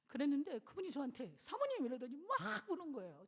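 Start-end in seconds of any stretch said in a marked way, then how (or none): 1.4-1.8 calling out. Retold or imitated. Deacon calling, then crying.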